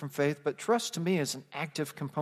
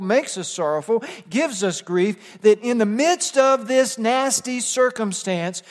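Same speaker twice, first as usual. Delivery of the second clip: ordinary talk, nothing marked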